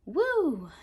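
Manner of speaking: a deep breath let out as woo; exhale woo. Shown as a demonstration. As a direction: blow the breath out slowly. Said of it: "let out a deep breath".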